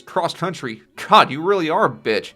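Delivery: mocking chuckle. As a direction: scoff like a bully. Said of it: "chuckles and says".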